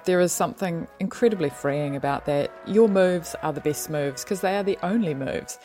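ordinary talk, nothing marked

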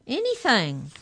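high, loud, falling plea; desperate pleading. A narrator's reading. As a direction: give in desperately, pitched high. Molly desperately agrees to anything.

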